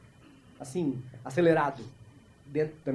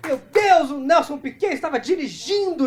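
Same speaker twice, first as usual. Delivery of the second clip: high, loud mock alarm; hammed-up gasp of disbelief; alarmed onlookers; 9 semitones higher, 7 dB louder, slower than usual. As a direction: shout it like a shocked bystander. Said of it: "As pessoas falam".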